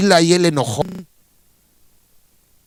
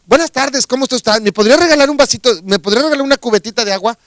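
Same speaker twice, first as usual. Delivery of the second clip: higher, fast, eager politeness; overly polite request; 6 semitones higher, 6 dB louder, faster than usual. Shown as a demonstration. Hermit rehearsing a nicer way to ask for water. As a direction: chirp the request higher and quickly. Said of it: "No, no, no".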